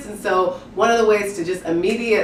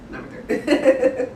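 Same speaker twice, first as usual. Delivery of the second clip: laughing; 6 semitones higher, 3 dB quieter, much slower than usual. Real, not acted. Correcting herself with a laugh.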